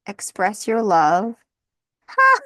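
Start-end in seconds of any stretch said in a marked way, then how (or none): 2.1-2.4 sharp high laughing bark; a loud surprised laugh; real laugh bursting out; sudden burst of laughter. Real, not acted. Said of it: "I just am cracking up".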